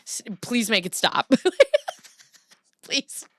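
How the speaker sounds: laughing, pained plea for it to stop; hammed-up agonised begging; mock pleading listener. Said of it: "Hound Servant, who's from the U K, was like".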